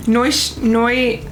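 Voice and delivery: usual speaking voice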